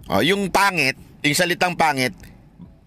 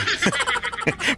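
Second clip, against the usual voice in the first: short high burst of laughter; real amused laugh; 4 dB quieter than usual. Real, not acted. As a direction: let a quick giggle out. Laughing during debate.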